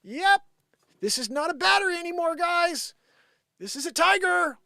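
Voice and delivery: high, loud forced cheer; mock chirpy agreement. Pretending to accept the battery is a tiger. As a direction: sell the lie brightly. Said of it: "you're like".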